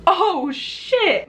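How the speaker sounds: high, drawn-out retold gasp of words; mock-horrified, high exclamation; shocked realization. Realizing head is cracked open.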